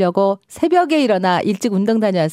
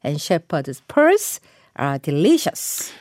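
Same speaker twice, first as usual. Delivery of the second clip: very slow, clear sentence for learners; slow model reading; much slower than usual. Shown as a demonstration. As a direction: read it slowly word by word. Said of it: "문장으로 만들어 볼게요". (천천히 또박또박 읽기)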